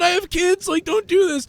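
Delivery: high-pitched panicked plea; pleading victim. Acted. Kidnapped man begging his captors, pleading. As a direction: beg them to stop in a high voice.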